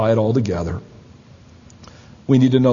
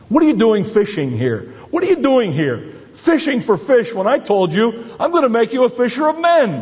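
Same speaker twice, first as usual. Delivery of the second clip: Jesus reproaching; 9 semitones higher than usual. Acted. Jesus confronting Peter fishing.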